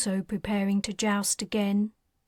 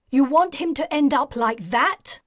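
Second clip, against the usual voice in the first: alarmed retort; 5 semitones higher, 5 dB louder than usual. Acted. Talon argues in alarm.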